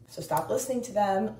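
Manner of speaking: ordinary talk, nothing marked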